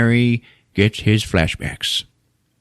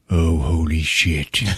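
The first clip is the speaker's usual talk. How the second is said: stunned; 4 semitones lower, slower than usual. Retold or imitated. Harry shocked by the shot.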